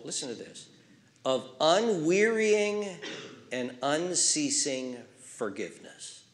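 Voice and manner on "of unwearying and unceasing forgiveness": slow, drawn-out words; slow careful reading. Shown as a demonstration. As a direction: savour each word slowly. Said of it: "I'll read slow".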